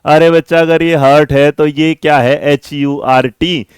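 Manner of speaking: usual speaking voice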